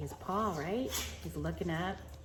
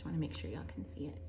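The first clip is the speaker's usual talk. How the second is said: quick whisper to the class; hushed quick aside; 2 semitones lower, 11 dB quieter, much faster than usual. Real, not acted. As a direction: murmur while holding up the page. Whispered aside while showing book.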